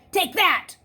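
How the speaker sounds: a high, loud burst as the glass gives way; triumphant shout. Acted. Luke smashing the office door glass, triumphant shout. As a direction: yell it as you smash the panel.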